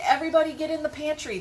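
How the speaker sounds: high, loud and fast retold order to hide; mother calling the kids into the pantry during a lightning strike, urgent. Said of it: "I'm like".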